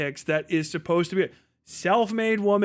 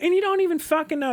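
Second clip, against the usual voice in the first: fast, higher mocking debater jab; quick mock gotcha voice; mock Andrew Wilson; 9 semitones higher, faster than usual. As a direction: sneer it out like a smug debater. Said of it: "one of those Andrew Wilsonisms".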